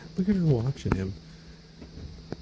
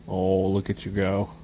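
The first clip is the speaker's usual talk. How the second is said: ordinary delivery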